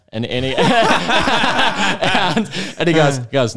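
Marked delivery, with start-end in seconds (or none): 0.1-2.5 loud laugh. Real, not acted. Burst of laughter in story.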